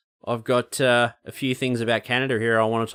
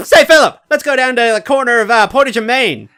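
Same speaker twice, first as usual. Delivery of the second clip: high, loud cartoon voice; Terrence and Phillip; 11 semitones higher, 8 dB louder than usual. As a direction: squeal it like a silly cartoon double act. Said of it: "Terrence Phillips style".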